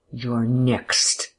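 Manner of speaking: throaty snarled threat; menacing growl from the throat; guttural, venomous snarl. A narrator's reading. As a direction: snarl it with venom, not volume. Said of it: "guttural and venomous, were like a snarl".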